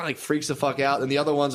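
ordinary talk

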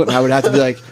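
ordinary talk

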